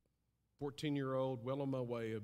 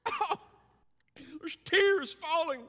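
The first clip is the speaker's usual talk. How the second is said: mock tearful; 11 dB louder, slower than usual. Mock crying over turtles.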